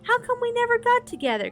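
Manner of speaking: put-on a girl grumbling she missed everyone; complaining girl voice. A narrator's reading. Reader voicing Sabrina higher.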